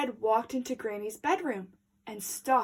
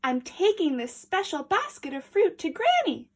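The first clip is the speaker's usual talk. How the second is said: put-on little girl voice; 6 semitones higher, 3 dB louder than usual. A narrator's reading. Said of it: "Little Red replied".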